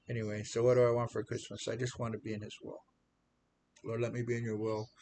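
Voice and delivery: ordinary talk, nothing marked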